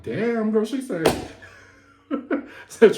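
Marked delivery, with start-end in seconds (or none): 0.0-0.6 loud, high impressed exclamation. Retold or imitated. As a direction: react like you felt the hit. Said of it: "I was like".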